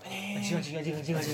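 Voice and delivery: rapid repeated mock-panicked curse; demonstrated fear. Shown as a demonstration. Showing how it sounds when scared, mock panic. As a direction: repeat it over and over in pretend fright. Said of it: "kalau takut".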